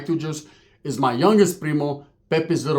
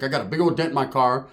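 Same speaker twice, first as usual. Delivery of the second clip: ordinary talk, nothing marked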